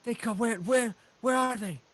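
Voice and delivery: high, urgent questioning; anxious halfling. Acted. Halfling looking for his family, anxious. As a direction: relief tipping into urgent searching.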